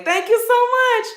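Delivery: beam it back at the compliment; gushing thanks. Real, not acted. Thanking a viewer for calling her beautiful, high and gushing.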